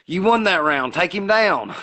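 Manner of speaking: a hammed-up ringside yell; Paulie urging. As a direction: pump the fighter up between rounds. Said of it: "Paulie comes over there and goes".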